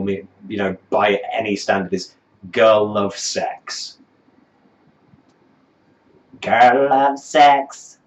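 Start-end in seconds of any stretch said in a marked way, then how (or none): 6.4-8.0 loud, slow, higher mock singer voice; mocking lyric impression. Critic ridiculing the song's worst line, loud slow mocking.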